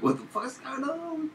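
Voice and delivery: high squeaky panicked quote; mock panic at the pile of cables; stressed panic. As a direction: freak out in a tiny high voice. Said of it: "stress mode of like".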